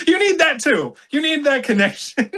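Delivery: high-pitched, through laughter; amused, voice lifted high; laughing. Real, not acted. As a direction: squeeze the words out in a high giggle. Jokes while laughing.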